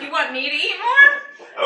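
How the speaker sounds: laughing. Retold or imitated. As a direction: giggle out the question. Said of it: "I was like".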